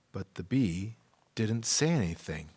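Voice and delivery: plain reading voice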